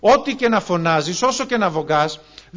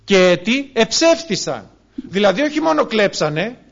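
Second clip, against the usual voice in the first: fiery preaching; 2 semitones higher, 5 dB louder than usual. Acted. Preacher warning the brothers about theft, loud fiery preaching. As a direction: hammer the point home from the pulpit.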